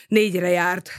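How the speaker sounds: usual speaking voice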